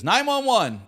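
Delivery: high, loud mock drunken yell; retold yell. Comic imitating an audience member interrupting his show, mock yell. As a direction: imitate a woman yelling out from the crowd. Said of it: "She's just drunk yelling".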